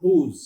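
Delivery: "whose" said as "hooz", ending in a buzzing z; model pronunciation. Shown as a demonstration. Teacher models whose for students to copy. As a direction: say whose slowly, landing on the z. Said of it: "थोड़ा उसको लंबा करना है".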